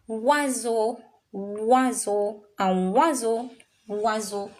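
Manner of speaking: oiseau said wa-zo; French oiseau. Shown as a demonstration. Teacher drilling oiseau, oi as wa. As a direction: repeat oiseau with a clear wa. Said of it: "a trick in the French language".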